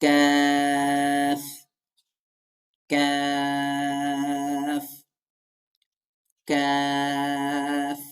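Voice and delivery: কাফ with a long, level, held vowel; drawn-out model কাফ for students to copy; demonstrated letter Kaf. Shown as a demonstration. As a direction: sound কাফ slightly forward of the tongue root, very slowly. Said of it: "সেখান থেকে যদি উচ্চারিত করি".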